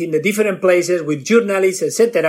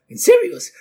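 incredulous; 13 semitones higher than usual. Retold or imitated. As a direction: pitch jumps up in disbelief. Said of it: "He was like".

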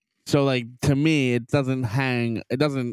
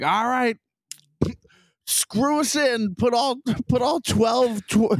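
high, drawn-out mock order; mocking crew voice; 11 semitones higher, slower than usual. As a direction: squeal it like a clueless crew member. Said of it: "they're like".

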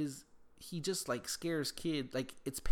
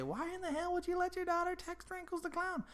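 high-pitched imitation of a scolding listener; mock outraged critic. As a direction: put on a high voice to challenge the dad. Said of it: "I get what you're gonna say".

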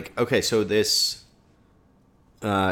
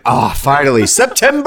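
mock relief; 5 semitones higher, 8 dB louder than usual. Mocking overhyped weather relief.

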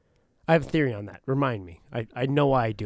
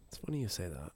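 much softer, lower, like a secret; hushed repeat; 6 semitones lower, 17 dB quieter, faster than usual. Shown as a demonstration. Friend teasing a juicy story, softly. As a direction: say it again under your breath.

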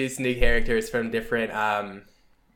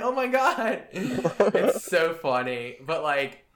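ordinary delivery

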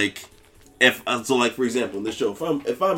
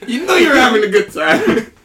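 teasing through laughter; laughing tease; 10 semitones higher, slower than usual. Retold or imitated. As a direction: call their bluff, laughing. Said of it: "I'm like".

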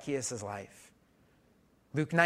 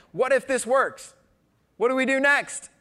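louder, higher, fired-up question; excited; 9 semitones higher, 7 dB louder than usual. Acted. Pastor urging the congregation on, excited and loud. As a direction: build it into a rallying cry.

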